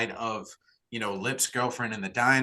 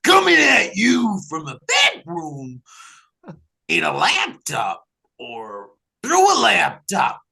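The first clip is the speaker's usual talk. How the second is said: hyped intro shout; 6 semitones higher, 8 dB louder, slower than usual. Acted.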